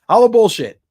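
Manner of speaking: loud, high hashtag shout; hype shout. Real, not acted. Streamer shouting the hashtag to chat, hyped and loud. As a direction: belt it out like a catchphrase. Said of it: "shouting the giveaway hashtag".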